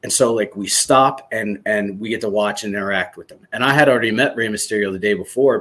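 ordinary talk

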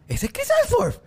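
very high incredulous question, imitating others; mock surprise. Imitating friends asking in disbelief, high and surprised. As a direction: squeak it out like someone who can't believe it. People asking about the actor.

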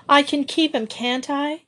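passionate plea. A narrator's reading. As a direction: beg with all your heart. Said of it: "wound up Joey passionately".

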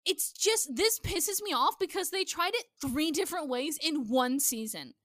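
ordinary talk, nothing marked

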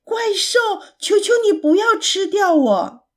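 high, urgent begging; pleading shout. A narrator's reading. Pig pulled from the pocket begs the monster, pleading shout. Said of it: "艾利克斯大喊着".

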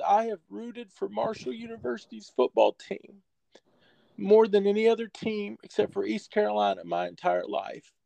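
put-on a touch of a quavering, tearful old coach; retold tearful coach. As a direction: hold back tears as you quote him. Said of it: "he fought back tears".

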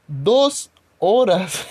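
Dos horas stretched very slow and loud; mock outrage, slow and loud; indignant emphasis. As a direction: drag out each word Dos horas in outrage. Indignant repeat of complaint.

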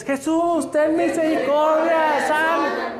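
high, slow, drawn-out plea; ragged group cry. Acted. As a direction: cry out to Jesus for mercy, badly together. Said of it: "Todos gritaban". (leprosos gritando desordenados pidiendo piedad)

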